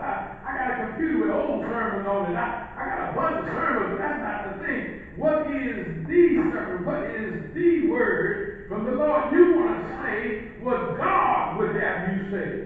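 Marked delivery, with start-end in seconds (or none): 5.1-12.7 slow, loud preacher's shout; preaching. Acted. Preacher asking the congregation for the word, slow and loud. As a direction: drive each word home. Sermon rising again.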